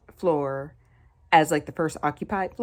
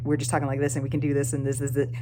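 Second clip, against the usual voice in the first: rapid Southern chatter; 2 semitones lower, faster than usual. Shown as a demonstration. Showing how she can't talk to Korean landlord, rapid Southern chatter. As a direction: rattle it off in casual Southern style. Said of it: "I can't do my Southern".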